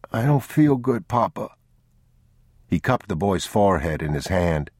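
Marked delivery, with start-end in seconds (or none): 0.1-1.5 lightly voiced sick child, higher; a small boy feeling ill.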